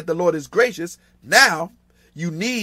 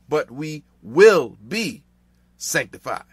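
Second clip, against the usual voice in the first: "will be" punched loud and slow, then "sanctified" dropping; emphatic, drawn-out "will be"; stress on "will be"; 5 dB louder, slower than usual. Real, not acted. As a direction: lean hard on "will be", separating both words. Future set against present state.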